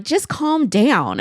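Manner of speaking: slow, drawn-out "Just calm down"; self-calming. Retold or imitated. Calming herself after upsetting the vendor, slow. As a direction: talk yourself down slowly.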